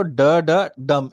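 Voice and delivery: "da, da, dum" beaten out with weight on DUM; anapest rhythm. Shown as a demonstration. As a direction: tap out "da, da, dum" as unstressed, unstressed, stressed. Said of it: "is the rhythm".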